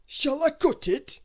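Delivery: Mister Greedy, shrugging off a cake, in a high slow voice; greedy character voice. Acted.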